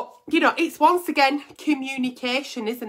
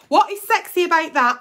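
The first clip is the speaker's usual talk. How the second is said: ordinary delivery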